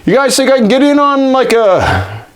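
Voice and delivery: high, hopeful butting in; imitating himself. He asks Ron and Chevy to let him join, pitched high. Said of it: "and then I'm like".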